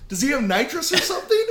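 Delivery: high, stunned retold disbelief; incredulous. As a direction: blurt it out high, baffled and amused. Retelling his shock at being outrun.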